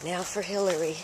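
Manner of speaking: normal talking voice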